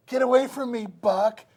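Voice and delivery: high mock outraged woman; rebuffing woman. Woman in the Western telling the cowboy off, high mock outrage. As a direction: shoo him off in a high huffy voice.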